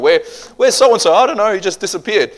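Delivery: lightly hammed-up confusion; acted puzzled exchange. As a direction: act out a worried question and clueless reply. Acted household asking after missing man.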